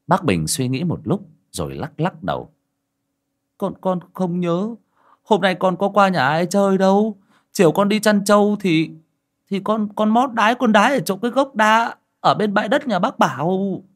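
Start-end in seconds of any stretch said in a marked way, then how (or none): 3.6-13.8 put-on high child's voice, halting; hesitant boy. A narrator's reading. Boy admitting where he peed, high hesitant voice. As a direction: confess it haltingly like a little boy.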